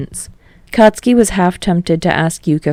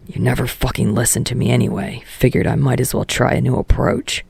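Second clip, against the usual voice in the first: put-on a lower, sullen teen voice; Katsuki's voice; 5 semitones lower, 5 dB quieter than usual. Katsuki brushing off Kirishima, in a lower sullen voice. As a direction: drop lower and grumble it out as Katsuki.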